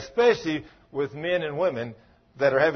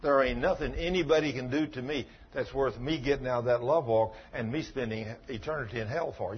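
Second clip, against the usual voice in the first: ordinary talk, nothing marked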